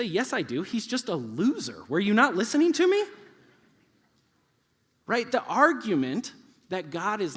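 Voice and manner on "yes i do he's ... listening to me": higher, indignant comeback building to a loud question; acted exasperation, rising at the end; exasperated kid. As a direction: insist like a kid nobody listens to. Voicing son insisting to adult.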